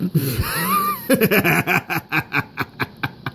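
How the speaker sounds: long high cackling fit; mocking laughter. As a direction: laugh helplessly at someone's silly name. Engine laughs at Oliver's name.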